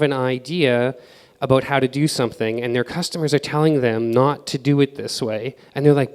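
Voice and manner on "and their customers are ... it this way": ordinary delivery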